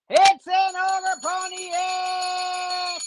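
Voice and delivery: high, slow, thrilled reveal; excited. Real, not acted. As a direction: cheer the big pull. Big hit card pulled.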